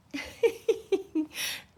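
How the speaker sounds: short high-pitched real giggle; high laugh. Real, not acted. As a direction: let a quick delighted laugh out. Laughs at dog in window.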